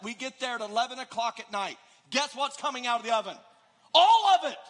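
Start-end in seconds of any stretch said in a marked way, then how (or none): 3.9-4.6 high, loud laughing burst; gleeful cry of giving in; laughing shout. Acted. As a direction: blurt it out through a laugh. Gleeful confession of eating pies.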